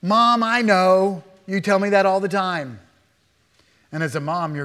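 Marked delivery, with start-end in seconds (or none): 0.0-2.8 put-on exasperated child. Child to mom after being told many times, exasperated. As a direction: drag out the protest, fed up. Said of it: "heard your children say to you".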